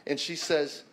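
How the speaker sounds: usual speaking voice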